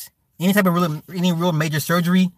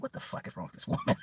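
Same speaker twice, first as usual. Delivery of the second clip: mock mom voice; 14 dB quieter, faster than usual. Mom crying at movies, voiced.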